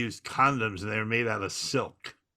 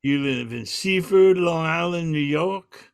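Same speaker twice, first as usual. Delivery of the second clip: higher, louder and slower mock Southern drawl; 5 semitones higher, 4 dB louder, slower than usual. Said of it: "He goes".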